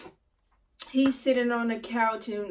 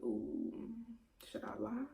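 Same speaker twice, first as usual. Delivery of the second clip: very soft, hesitant wondering aloud; hesitant inner debate; 15 dB quieter than usual. Retold or imitated. Girlfriend weighing a lie to the man, soft hesitation. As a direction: think it through under your voice.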